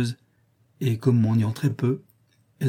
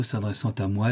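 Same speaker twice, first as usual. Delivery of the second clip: ordinary delivery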